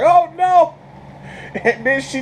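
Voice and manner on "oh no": high, loud cry of dismay; hammed-up horrified moviegoer; mock horror. Viewer reacting to a gory death in the theater, mock horror.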